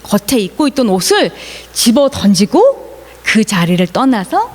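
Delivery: usual speaking voice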